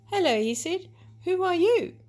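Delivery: high, loud, curious hello; eager greeting. Acted. Young buck greets a lovely doe, eager and curious. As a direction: greet her with bright curiosity.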